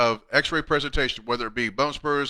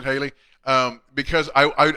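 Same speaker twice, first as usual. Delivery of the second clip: ordinary delivery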